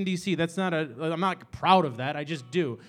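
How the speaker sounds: ordinary talk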